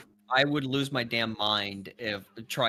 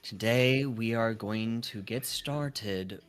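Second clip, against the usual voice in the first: game master narration; 4 semitones lower than usual. Acted. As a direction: set the scene for the players, unhurried. DM narrates scene.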